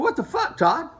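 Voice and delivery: a mock exasperated protest, voice jumping up; indignant outburst. Retelling confronting track owner Todd, high indignant protest.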